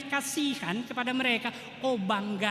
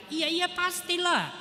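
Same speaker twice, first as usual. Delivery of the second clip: sarcastic agreement; 9 semitones higher, slower than usual. Acted. Sarcastic reply to complaint.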